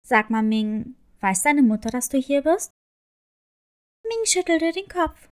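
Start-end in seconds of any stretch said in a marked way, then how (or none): 0.1-2.7 low, kindly crab character; friendly crab voice. A narrator's reading. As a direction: speak as a gentle low crab. Crab speaks to Ming.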